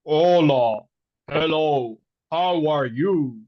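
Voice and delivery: deliberately unchildlike slow voice; wrong little-girl impression. Shown as a demonstration. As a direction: greet slowly in a low adult voice, not a child's. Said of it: "A five-year-old little girl doesn't talk like this".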